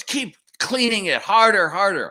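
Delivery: hammed-up taskmaster yelling at him to scrub; screeching boss. Boss's mother yelling at the young janitor to scrub, loud mock screech. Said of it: "And she goes".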